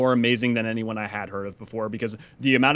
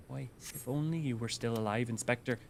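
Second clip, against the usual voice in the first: soft, failed Cockney attempt; Cockney slipping Midwestern; 11 dB quieter than usual. Streamer retrying the Inspector line, Cockney sliding into Midwestern. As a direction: quietly try Cockney but drift into Midwestern. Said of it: "now I'm just going Midwestern".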